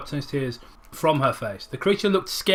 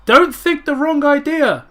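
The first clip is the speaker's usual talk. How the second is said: much higher, slower, loud; flustered pleading; 11 semitones higher, 8 dB louder than usual. Acted. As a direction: plead to explain in a panic. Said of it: "tried to explain".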